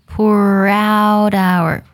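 very slow, "pour out our" run together; linked pronunciation. Shown as a demonstration. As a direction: glide "pour out our" as one sound. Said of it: "那么连起来就是". (很慢地把pour out our连在一起)

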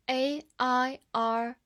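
letters spelt out slowly; slow spelling. Shown as a demonstration. Teacher spells the word slowly for the class.

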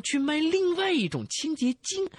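usual speaking voice

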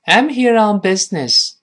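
high, loud model sentence; model reading. Shown as a demonstration. Teacher repeating the line for the class, loud model reading. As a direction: read "I'm here on business" high and loud, short vowels in "business". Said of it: "再来一遍".

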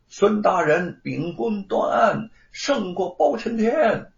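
high, slow chanting call; crowd acclaim. A narrator's reading. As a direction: hail the judge with a mildly acted cheer. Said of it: "一起的高呼". (拖长声音高呼)

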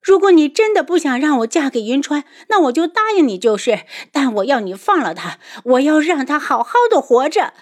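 high, loud, anguished bargaining; distressed plea. A narrator's reading. Daughter begging her father for her lover's life, distressed. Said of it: "难过又悲伤地看着安国公".

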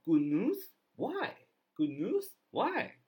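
surprised, high rising questions; Good news? Why? asked in disbelief; Why? leaps up high in surprise; puzzled doctor. Acted. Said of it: "医生就很奇怪".

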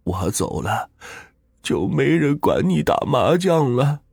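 dying wife apologizing to her husband; slow, rising to a crying wail; tearful farewell. A narrator's reading. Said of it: "这说着便哭出了声来".

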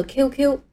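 "Q Q" said as "kyoo kyoo"; English letter names in "Q Q"; correct letter pronunciation. Shown as a demonstration. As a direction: give "Q Q" its k plus "you" sound. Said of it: "但是很容易念错".

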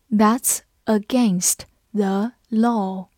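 slow, word by word with gaps; slow read-along. Shown as a demonstration. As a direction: read it slowly for learners to follow. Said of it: "我们慢慢来读". (慢慢逐词朗读)

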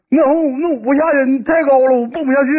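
very high mock-pitiful voice; scared whining. Friend stuck on a high wall, whining in mock fear.

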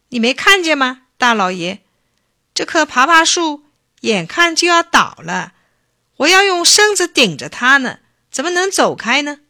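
loud, high, insistent protest; protesting excuse. A narrator's reading. Bacang claims he must prop the tree, protesting. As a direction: plead your excuse at high pitch.

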